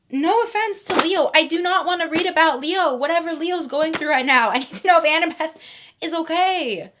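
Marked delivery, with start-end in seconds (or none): 0.1-4.5 exasperated rant. Real, not acted. Indignant rant.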